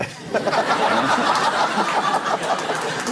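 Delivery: long airy laugh after a joke; hearty laugh; breathy laugh. Real, not acted. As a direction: laugh at your own bravado.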